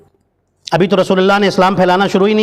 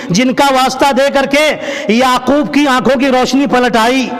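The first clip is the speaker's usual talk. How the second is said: pleading recital; 4 semitones higher than usual. Acted. Abu Talib pleads, reciting intercessions.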